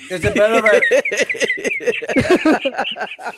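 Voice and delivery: laughing. Real, not acted. Moving away from mic.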